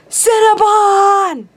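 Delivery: high, drawn-out squeal of "Cinnabon!"; excited drawn-out yell of "Cinnabon!"; screamed Cinnabon. Retold or imitated. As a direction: shriek "Cinnabon!" slowly and high. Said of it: "I just screamed Cinnabon at him".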